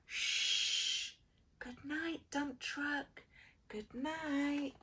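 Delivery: soft, gentle shushing good night; hushed. A narrator's reading. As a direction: hush as if a child sleeps. Hushed bedtime refrain.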